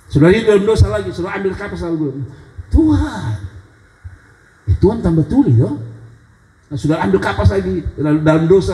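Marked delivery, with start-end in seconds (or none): 2.7-3.3 high plea to God; crying out. Retold or imitated. Sinner crying out to God while plugging ears. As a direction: call out to God, pleading.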